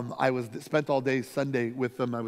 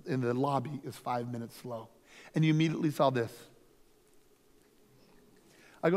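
usual speaking voice

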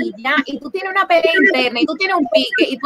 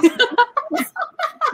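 a sudden high laugh that bursts out; high burst of laughter; 11 semitones higher than usual. Real, not acted. Friend cracks up at the bottle joke, laughing high.

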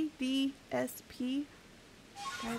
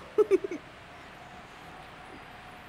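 breathy high laugh; 7 semitones higher than usual. Real, not acted. Laughing at loud noises.

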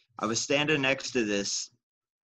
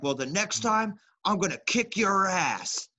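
higher, slow mock threat; threatening trucker; 9 semitones higher than usual. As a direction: drag out the threat like a tough guy.

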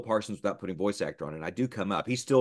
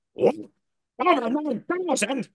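high-pitched cartoon villain outburst; Nappa; 3 dB louder than usual. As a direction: shoot the voice way up in disbelief. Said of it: "I can go right into Nappa".

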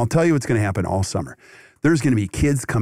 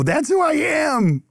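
high, slow and proud; proud declaration; slower than usual. Retold or imitated. Dad realizing who he is, said high and proud. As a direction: announce it like a revelation. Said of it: "and go".

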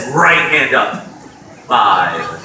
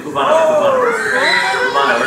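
calling out; faster than usual. Real, not acted. Calling crowd to move.

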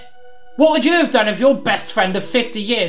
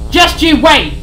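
higher, louder warning; furious threat; 8 semitones higher, 6 dB louder than usual. Acted. Sid warns Harry as he's pulled back. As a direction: threaten him. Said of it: "Sid continued to rage".

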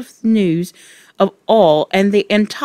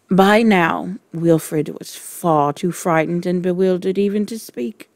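usual speaking voice

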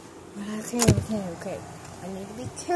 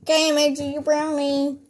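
shouted call to the others; 3 semitones higher, 8 dB louder than usual. Acted. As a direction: loudly announce it's ready. Calls out that food is ready.

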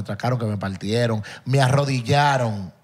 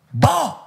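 sharp blow sound; 10 semitones higher than usual. Retold or imitated. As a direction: snap out the sound of the hit. Acting out the blow landing.

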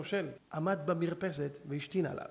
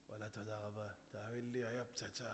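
lower, much softer grateful voice; quiet thanks; 7 semitones lower, 13 dB quieter than usual. Retold or imitated. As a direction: murmur your thanks sheepishly. The heckler thanks him quietly.

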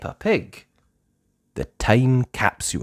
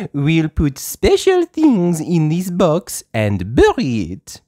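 high, slow, fluttery French teacher voice; a gentle teacher speaking slowly to little children; Madame Gazelle voice; 8 semitones higher, 8 dB louder, slower than usual. Acted. Teacher to her playgroup, high and slow.

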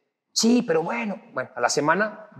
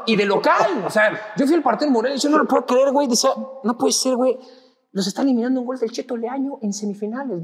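higher, worked-up disbelief; disbelieving excitement; 8 semitones higher than usual. Retold or imitated. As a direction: relive the shock of the upset. Reliving an unbelievable match loss. (incrédulo y exaltado al recordar la eliminación)

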